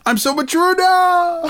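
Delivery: a goofy boast in a lifted voice; high silly druid voice. Pretend. The druid brags to Mother Earth about his new teeth, high and giddy.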